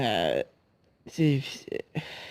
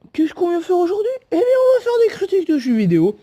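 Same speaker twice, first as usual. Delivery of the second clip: very high, excited host voice; high-voiced host; 12 semitones higher, faster than usual. Announcing game reviews to a sidekick, high-pitched put-on host voice. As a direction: pitch it way up like a kids' show presenter. Acted exchange with puppet-like second character.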